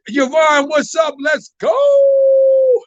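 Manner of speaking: high, loud call building to a yell; rising to a loud high "Let's go"; hyped shout-out. Real, not acted. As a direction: shout the viewer's name and hype them up. Host hypes up a viewer.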